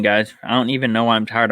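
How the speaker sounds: ordinary delivery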